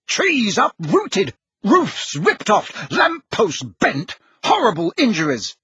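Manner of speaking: slow, high, emphatic list; stamping anger. A narrator's reading. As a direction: hammer out each item indignantly. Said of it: "now positively stamping up and down".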